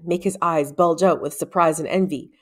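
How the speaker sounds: usual speaking voice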